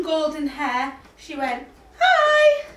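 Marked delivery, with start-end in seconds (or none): 2.0-2.6 put-on high, bright little-girl hi; girl's hello. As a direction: squeak a loud hi as Goldilocks. Girl greets in story.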